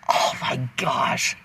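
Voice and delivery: breathy slow whisper of disbelief; stunned whisper; whispered gasp. Retold or imitated. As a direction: hush it out slowly like you can't believe it. Whispered reaction to the memory.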